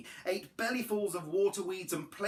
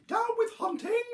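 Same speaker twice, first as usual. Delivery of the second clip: very high, loud duckbill hoot; high honking protest; 5 dB louder than usual. A narrator's reading. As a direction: hoot it out far above your usual voice. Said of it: "he hooted".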